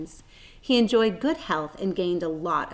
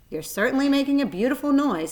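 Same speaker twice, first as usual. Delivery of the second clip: snapping; 6 semitones higher, 4 dB louder, faster than usual. A narrator's reading. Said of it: "snapped the old sheep".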